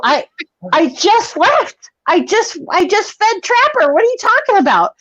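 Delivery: high-pitched acted-out defensive protest; bewildered protest. Child answering screaming mom, high bewildered protest. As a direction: protest your innocence as an accused kid, high.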